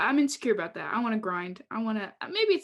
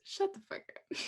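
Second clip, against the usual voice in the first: whispered mock put-down; mock shushing; 10 semitones higher, 7 dB quieter than usual. Wanting a sticker that says this, whispered. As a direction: hush it out as a joke. Reads imagined sticker as a joke.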